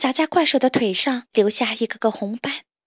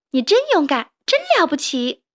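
excited praise; 6 semitones higher than usual. Acted. Little toad praises grandpa, high excited voice. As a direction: gush over grandpa's bravery. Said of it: "他叫道".

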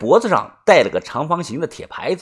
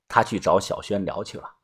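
ordinary delivery

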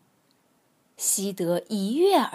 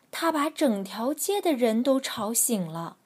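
ordinary delivery, nothing marked

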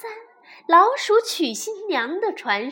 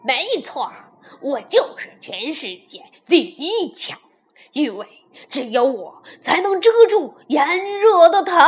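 ordinary delivery, nothing marked

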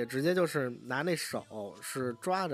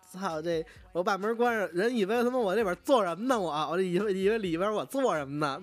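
mock exasperated rant, a bit higher; retold angry outburst; 6 semitones higher than usual. Doctor fed up with the patient tugging his coat, mild mock anger. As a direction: play the doctor losing his temper, half-acted. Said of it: "后来那个大夫急了".

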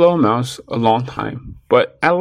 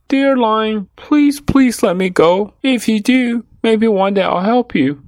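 high, insistent mouse pleading; begging little mouse; 10 semitones higher, 4 dB louder than usual. A narrator's reading. Mouse bargaining with the lion for his freedom, begging. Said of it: "kept talking and begging".

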